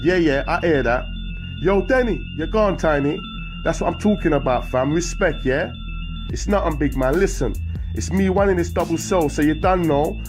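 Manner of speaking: high, loud promise; calling out. Acted. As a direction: yell across the wing. Calling out window.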